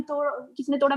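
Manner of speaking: soft, quiet demo of the same question; speaking softly. Shown as a demonstration. Showing the quiet way she asked the housemate. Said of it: "मैं धीरे-धीरे बोल रही हूँ".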